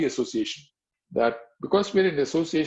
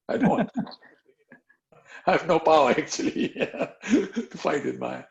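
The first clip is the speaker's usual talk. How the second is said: laughing; 2 semitones higher, slower than usual. Real, not acted. Laughs at own admission.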